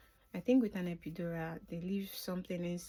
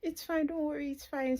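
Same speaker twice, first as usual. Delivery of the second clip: lightly voiced husband, higher and calming; husband reassuring; 9 semitones higher, 4 dB louder than usual. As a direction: soothe a worried wife. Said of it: "My husband was like".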